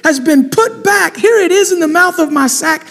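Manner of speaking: a brother startled, lightly acted; alarmed brother. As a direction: show shock at finding the money. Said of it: "He said to his brothers".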